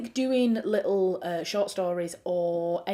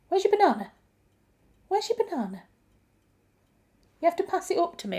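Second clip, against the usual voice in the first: high-pitched questions to a dog; playful pet talk; 10 semitones higher, faster than usual. Real, not acted. Asking the dog to pass its banana, high pet talk.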